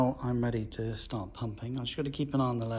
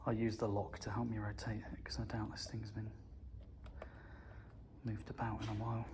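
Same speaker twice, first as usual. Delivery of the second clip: whispering; 9 dB quieter than usual. Real, not acted. Quiet focused work aside.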